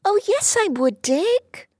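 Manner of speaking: put-on high girlish protest; protesting little girl. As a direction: protest eagerly. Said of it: "protested Dulcie".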